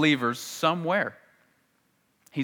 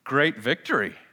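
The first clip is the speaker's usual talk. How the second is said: normal talking voice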